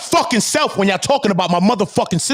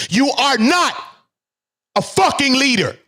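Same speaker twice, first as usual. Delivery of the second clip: high, hard stress on NOT, then a pause; emphatic telling-off; 6 semitones higher than usual. Real, not acted. Streamer telling a man he is no leader, emphatic rebuke. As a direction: drive home "are NOT", pause, then land "fucking leader". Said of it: "Do I have to say it like that".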